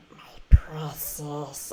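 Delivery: slow creepy whisper over the shoulder; Gollum whisper. Retold or imitated. Sneaking up behind friends to whisper as Gollum. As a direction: hiss it slowly into a friend's ear. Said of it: "what Gollum would say".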